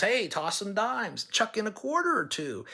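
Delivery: mock teasing dare to the classmates; cheeky dare. Taunting classmates in story.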